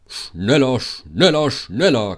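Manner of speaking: loud, puffing urge to speed up; panting urgency. A narrator's reading. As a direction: puff it out like a racing engine. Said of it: "keuchte er". (laut keuchend angetrieben)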